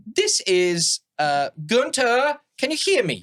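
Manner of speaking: high, slow gnome voice; squeaky little gnome calling into their head. Acted. Gnome messenger speaking into a hero's mind, high and slow.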